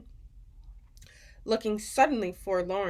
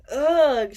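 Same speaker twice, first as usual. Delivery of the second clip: loud, higher sputtered ugh of revulsion; disgusted ugh; 5 semitones higher, 10 dB louder than usual. A narrator's reading. Sophie tasting the Snozzcumber, sputtering ugh. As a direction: spit out a grossed-out ugh. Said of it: "she sputtered".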